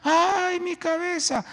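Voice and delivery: put-on very high, slow child's whimper; whining sick child. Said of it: "un niño decía". (niño quejándose con voz muy aguda)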